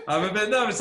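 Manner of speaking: loud, laughing admission of nerves; laughing confession. Retold or imitated. Laughing as he recalls sharing the nerves backstage. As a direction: laugh it out as you own up.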